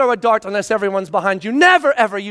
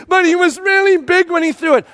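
put-on higher, louder child complaint; whiny kid; 8 semitones higher, 3 dB louder than usual. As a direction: plead like a hurt kid. Son whining voiced.

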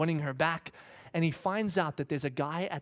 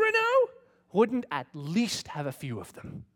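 voice of God; 4 semitones higher, 4 dB louder, slower than usual. Retold or imitated. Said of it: "God whispered to me and said".

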